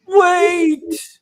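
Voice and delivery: sudden loud high cry of wait; shouted call. Acted. Kid yelling after the guard to stop. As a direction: call out to stop him leaving.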